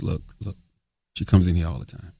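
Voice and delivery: confiding hush. Retold or imitated. Bank worker quietly confiding about the nutty regular. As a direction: lean in and share a secret. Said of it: "she goes".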